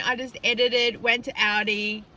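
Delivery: usual speaking voice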